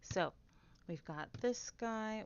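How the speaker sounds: ordinary talk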